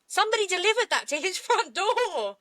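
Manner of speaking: high, disbelieving outburst; incredulous. Real, not acted. Co-host aghast at a crossbow sent to his door, incredulous. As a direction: voice leaping up in real disbelief.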